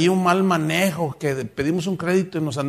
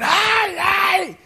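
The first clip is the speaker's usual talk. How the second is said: high shrieked cries of pain; loud mock shriek of pain; pained cry; 14 semitones higher, 3 dB louder than usual. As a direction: imitate a woman being struck. Beaten wife crying out.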